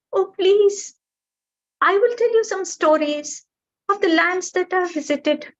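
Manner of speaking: high, begging small bird voice; pleading little bird. Acted. Bird begging the mountain to let it rest, high and pleading.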